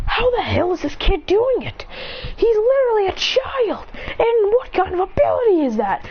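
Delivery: high-pitched, incredulous outburst; disbelieving, voice jumping up; acted astonishment. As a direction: exclaim as stunned onlookers. Watching heroes are astonished.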